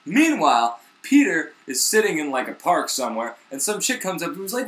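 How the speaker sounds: ordinary talk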